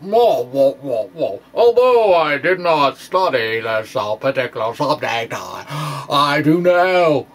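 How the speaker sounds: lightly hammed-up boastful crank physicist; a hint of a blustering know-it-all; mock pride bluff. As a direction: play a smug fringe physicist, half-joking. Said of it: "fringe physicist false, you know, pride bluff".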